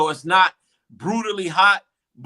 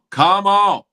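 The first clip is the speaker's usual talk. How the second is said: loud, insistent acted outburst; exploding exasperation; 2 semitones higher, 9 dB louder than usual. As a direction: burst out at the team, fed up. Same plea, burst louder.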